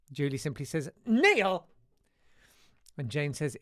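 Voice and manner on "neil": loud, high mock cry of a name; mock exclamation. Host reading out Julie's reaction, a loud mock exclamation. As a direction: call the name out in mock outcry. Reads out listener's one-word reaction.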